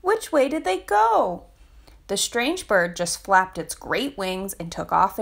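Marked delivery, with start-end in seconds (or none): 0.0-1.4 high, scared little voice; fearful cry. A narrator's reading. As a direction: call out anxiously, lost. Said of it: "cried Little Penguin fearfully".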